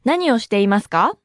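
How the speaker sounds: 何をしていますか？ with the final か lifted upward; rising intonation. Shown as a demonstration. Teacher models the formal question, 何をしていますか？ rising at the end. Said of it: "Note the rising intonation".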